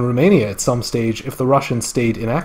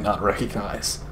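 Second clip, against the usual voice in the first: quiet aside; 4 semitones lower, 10 dB quieter than usual. Shown as a demonstration.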